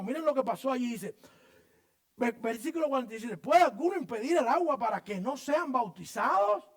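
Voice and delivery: high, loud pulpit declamation; full-force preaching. Real, not acted. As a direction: preach it out to the whole church.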